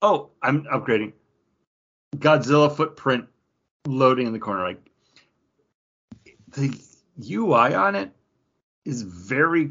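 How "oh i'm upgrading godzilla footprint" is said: usual speaking voice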